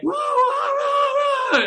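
high hollering whoa, whoa, whoa; drunken night yell. Retold or imitated. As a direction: holler it out into the street at 3 a.m. Drunk neighbour yelling at night.

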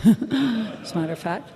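ordinary talk, nothing marked

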